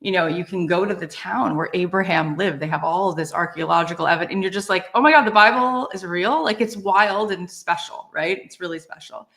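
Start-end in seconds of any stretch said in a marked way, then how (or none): 4.9-6.4 a burst of awe, a little louder; retold wonder at holy sites; amazed. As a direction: gasp it out like you just found proof. Retelling her amazement at archaeology.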